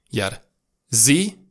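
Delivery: ordinary talk, nothing marked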